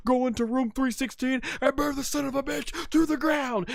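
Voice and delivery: hammed-up Stone Cold threat; Stone Cold promo. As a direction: menace him with a promo. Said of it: "He says".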